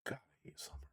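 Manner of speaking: whispered exasperation. Real, not acted. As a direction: breathe it out in a whisper.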